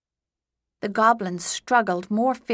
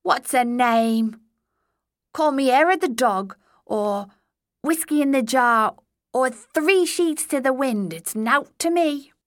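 goblin voice; 5 semitones higher, 5 dB louder than usual. A narrator's reading.

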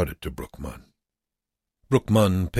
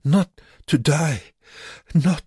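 high, slow, halting plea; pleading; 11 semitones higher, 5 dB louder than usual. A narrator's reading. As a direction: beg for life word by word. Sick Joseph pleads for life.

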